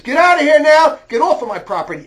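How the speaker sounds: loud, high yell to get off the property; furious shout. Retold or imitated. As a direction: order him off, shouting. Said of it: "I was furious".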